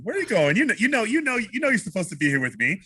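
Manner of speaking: high, playful coaxing; cute high-pitched wooing; smiling. Shown as a demonstration. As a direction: tease her to stay, voice lifted. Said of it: "the smile on your face".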